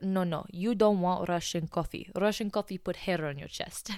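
imitated Russian customer, heavy accent; thick Russian accent. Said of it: "with a thick Russian accent".

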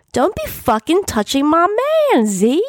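high-pitched protective shout. A narrator's reading. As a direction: yell a warning to back off. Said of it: "Red Raven shouted at me".

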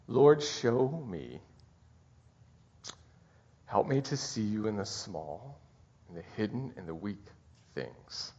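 unhurried, reverent petition; prayer. Shown as a demonstration. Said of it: "to pray this".

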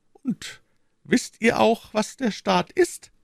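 slow, dismissive question; wife mocks her husband's phrase, shrugging. A narrator's reading. Slow and scornful. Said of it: "fragte Madame Bonacieux, die Achsel zuckend".

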